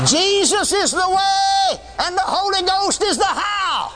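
high, slow, shouted proclamation; full-force preaching. Acted. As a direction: thunder it out to the congregation.

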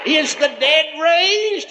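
high, slow, wondering ask; grandpa's amazed question. Retold or imitated. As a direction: ask it in awe, slowly.

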